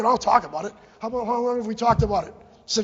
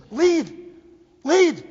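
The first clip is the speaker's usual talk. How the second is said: one-word command, high and much louder; impassioned shout; 7 semitones higher, 9 dB louder than usual. Real, not acted. Impassioned parent urging town officials to lead, shouted. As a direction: shout it as an urgent demand. Urging the town's leaders to act.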